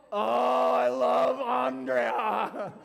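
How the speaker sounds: high, loud, drawn-out lovestruck shout with a laugh; lovestruck cry. Retold or imitated. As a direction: belt it out like a smitten teen. Teen self falling in love.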